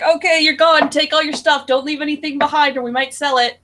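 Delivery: imitating parents. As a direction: play a parent briskly seeing the kid out. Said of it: "my parents were a bit the same way".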